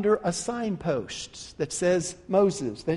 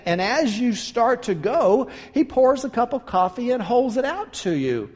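ordinary talk, nothing marked

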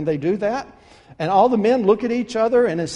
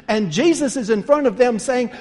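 normal talking voice

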